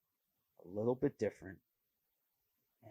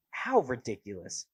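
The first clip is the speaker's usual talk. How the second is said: ordinary talk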